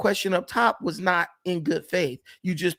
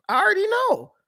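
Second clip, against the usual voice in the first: high-pitched mocking of the viewers' objection; 14 semitones higher than usual. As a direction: pitch it way up, teasing.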